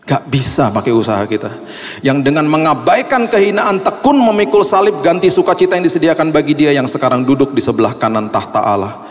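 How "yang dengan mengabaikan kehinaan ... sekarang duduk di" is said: fervent scripture. Acted. Scripture read with fervour.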